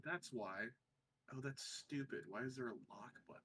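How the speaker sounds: ordinary talk, nothing marked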